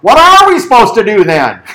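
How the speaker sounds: 人群中起哄者大声喊叫; 从台下高声冲着摩西喊; heckler yelling. Retold or imitated. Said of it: "yells out".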